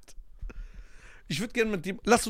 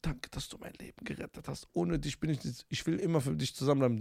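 soft unvoiced whisper, lover's gratitude; whispered movie-heroine thanks; 8 dB quieter than usual. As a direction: breathe it like a film heroine. Voicing woman in imagined film.

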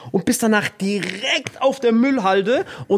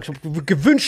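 normal talking voice

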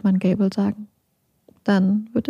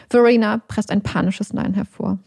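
plain reading voice

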